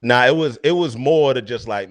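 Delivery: ordinary talk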